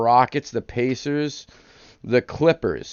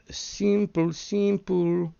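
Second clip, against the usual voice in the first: high sing-song repeat of simple; playful high sing-song; 10 semitones higher, much slower than usual. Acted. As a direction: lilt it up high like a little tune.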